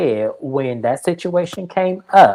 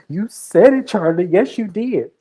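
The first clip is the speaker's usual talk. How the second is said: insistent accusation; 7 semitones higher than usual. Retold or imitated. Georgia insisting Charlie said it, mock heated. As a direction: push the accusation up high.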